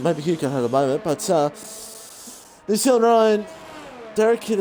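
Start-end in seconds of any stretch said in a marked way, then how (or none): 2.7-4.6 higher, louder cry of the leader's name; excited callout. Real, not acted. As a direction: call out the leader with a lift. Calling out the race leader.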